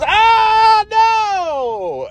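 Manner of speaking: high shrieking cry of no; mock scream. Imitating the host screaming at the kid's request, shrieking. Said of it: "just starts screaming".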